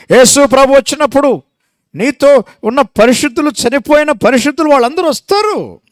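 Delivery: high, loud rising proclamation; preaching at full force. Real, not acted. Preacher proclaiming to the church, high and loud. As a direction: proclaim the coming like a herald.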